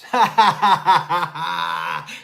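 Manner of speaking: big loud laugh; loud real laugh, cracking up; loud burst of laughter. Real, not acted. Laughing at friend's box.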